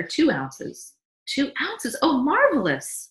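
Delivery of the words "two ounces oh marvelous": high, amazed echo, then a loud exclamation; delighted. Acted. Wife hears baby took more milk, delighted. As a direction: marvel at it, pitched way up.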